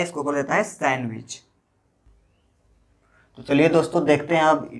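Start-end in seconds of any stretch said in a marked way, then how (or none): 0.6-1.4 सैंडविच said as "san-wich"; सैंडविच with n running into w; silent D. Shown as a demonstration. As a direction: leave the D out of सैंडविच. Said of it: "डी का उच्चारण नहीं किया जाता है".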